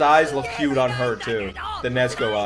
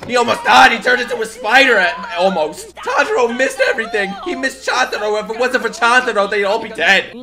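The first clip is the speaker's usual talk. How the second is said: heated defense; 7 semitones higher, 5 dB louder than usual. Real, not acted. Defending Zenitsu to the screen.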